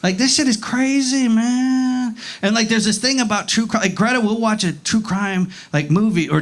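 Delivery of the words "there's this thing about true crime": ordinary talk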